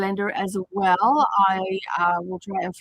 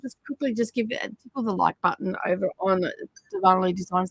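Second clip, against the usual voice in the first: usual speaking voice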